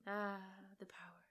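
breathy mock-grand whisper. Real, not acted. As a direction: hush it like a villain savoring power. Joking aside.

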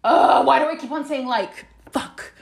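exasperated self-talk. Retold or imitated. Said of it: "I was like".

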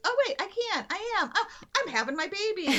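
high-pitched dawning amazement; sudden realization. Retold or imitated. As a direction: act out her astonished discovery, pitch jumping up. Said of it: "all of a sudden they realize".

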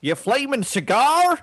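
slow, high mock-Australian outburst. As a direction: ham up a bad Australian accent, rising high. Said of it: "less stereotypical of the Australian people".

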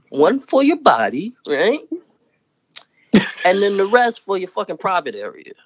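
usual speaking voice

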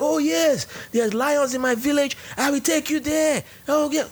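mock eager Cameroonian. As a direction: ham up an overeager promise of lions. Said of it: "he's like".

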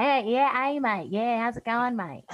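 mock a broad Australian bogan greeting a mate; Australian bogan accent. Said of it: "People who talk like that".

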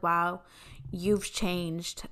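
understated, let-down judgment; typical hurt disbelief. Retold or imitated. Sister to her changed brother, quietly let down. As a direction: deliver it as a stock disappointed reaction. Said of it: "the typical, like".

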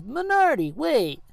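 a hammed-up anime voice; anime boy. Blue-haired boy stopping Minardi with a high call. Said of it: "the anime boy with blue hair says".

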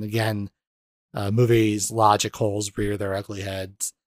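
usual speaking voice

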